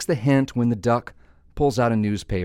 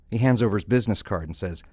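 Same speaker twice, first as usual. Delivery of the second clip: ordinary talk, nothing marked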